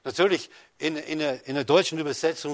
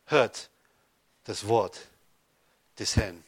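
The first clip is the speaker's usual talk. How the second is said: each phrase set apart by pauses, falling lower; solemn proclamation; 6 semitones lower, slower than usual. Acted. Preacher calling the church to hear God's word, solemn and halting. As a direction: announce "Hört das Wort des Herrn" word by word, sinking low.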